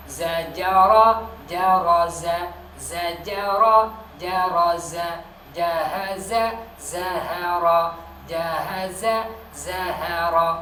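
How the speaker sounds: Zajara and jaraza drawn out loud, za against ja; loud slow pronunciation drill. Shown as a demonstration. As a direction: stretch each word for the class. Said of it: "Za sama ja lagi". (latihan za dan ja, lambat keras)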